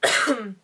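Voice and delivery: sharp loud throat clearing. Real, not acted. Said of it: "J'ai un truc dans la gorge".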